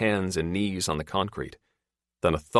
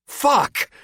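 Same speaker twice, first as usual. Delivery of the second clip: sharp, much higher, louder curse; growled curse; 7 dB louder than usual. A narrator's reading. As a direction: spit it out as she falls. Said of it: "she growls".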